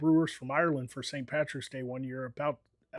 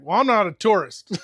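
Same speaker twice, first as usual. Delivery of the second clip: retold indignant retort; 5 semitones higher, 10 dB louder than usual. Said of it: "and I was like".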